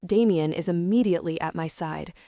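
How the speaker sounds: ordinary delivery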